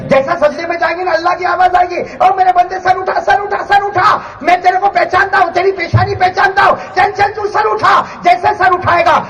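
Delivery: high-pitched urgent calling; impassioned preaching. Acted. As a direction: call the servant up from prostration, voice held high. Preacher voices God calling His servant. (پرجوش، اونچی آواز میں پکار)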